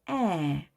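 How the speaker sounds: 에 drawn out as an open English short-a; jaw-dropped vowel. Shown as a demonstration. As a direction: drop the jaw for a wide 에. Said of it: "턱을 내리고". (턱을 내리고 크게 벌려 '에')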